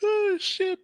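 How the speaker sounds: high, loud gleeful cry; excited shriek. Real, not acted. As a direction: shriek with glee. Player reacts to roll.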